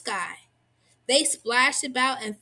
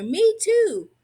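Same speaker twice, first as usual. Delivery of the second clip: high, louder eager cry; children chiming in; 9 semitones higher, 5 dB louder than usual. A narrator's reading. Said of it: "the others chimed in".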